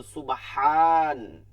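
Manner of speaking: সুবহান with a very long loud "haan"; emphatic long vowel. Shown as a demonstration. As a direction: stretch the second syllable of সুবহান loudly. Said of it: "এর মধ্যে কি ছিল?". (জোরে দীর্ঘ টান)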